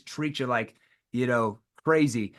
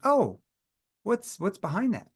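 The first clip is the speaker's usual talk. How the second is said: playful curious; 5 semitones higher than usual. Shown as a demonstration. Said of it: "hear my tone".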